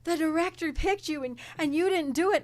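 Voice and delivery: upset mother. Retold or imitated. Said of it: "She's like".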